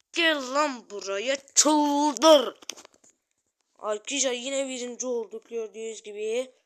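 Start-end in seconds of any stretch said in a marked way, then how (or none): 0.1-2.6 very loud, high, slow, dragged-out yell; furious shout. Real, not acted. Shouting at El Primo to come and fight, furious drawn-out yell. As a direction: scream it, stretching every word.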